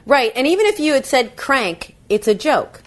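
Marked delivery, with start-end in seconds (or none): none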